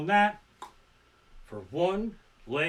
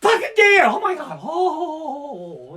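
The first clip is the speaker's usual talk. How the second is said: much higher, much louder terrified yell; imitating a frantic shout that breaks into a cry; panicked scream; 13 semitones higher, 12 dB louder, much slower than usual. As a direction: shriek like a deer is in the headlights. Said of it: "And I'm like".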